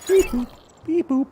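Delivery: high robot beeps; playful toy robot beeping. Pretend. Voicing the robot again.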